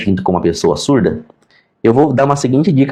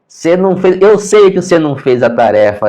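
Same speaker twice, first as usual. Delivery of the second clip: slightly higher, insistent retold telling-off; mock scolding; 8 semitones higher, 4 dB louder than usual. Teacher accuses pupil over homework, mildly acted scolding. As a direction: imitate a teacher catching out a pupil.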